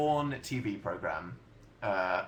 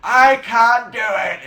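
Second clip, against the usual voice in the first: imitating a whole chat wailing; hammed-up loud, high, drawn-out shout; mock frustrated yell; 10 semitones higher, 15 dB louder, slower than usual. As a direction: yell it slowly in mock despair. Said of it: "suddenly everyone's like".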